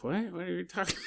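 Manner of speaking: usual speaking voice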